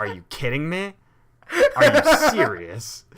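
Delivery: high, loud, slow, laughing disbelief; incredulous. Retold or imitated. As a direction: replay the shock with a laugh. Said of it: "I was like".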